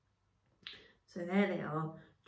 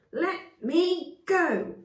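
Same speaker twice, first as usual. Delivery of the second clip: desperate shout; 11 semitones higher, 7 dB louder, much slower than usual. Acted. Chaya kicking her captors.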